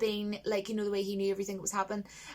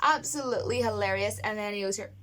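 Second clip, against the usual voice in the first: ordinary talk, nothing marked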